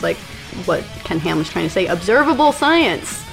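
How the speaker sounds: normal talking voice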